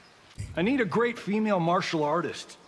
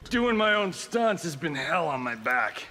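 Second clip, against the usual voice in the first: ordinary delivery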